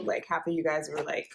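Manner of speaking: normal talking voice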